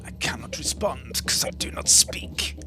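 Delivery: soft whispered aside; whispering. Acted. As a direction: whisper it like a mute child. Mute character answers.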